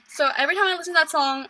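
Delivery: ordinary delivery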